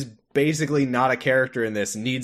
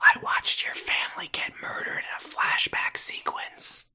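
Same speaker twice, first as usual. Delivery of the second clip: whispering; 4 dB quieter than usual. Pretend. ASMR roleplay whisper bit.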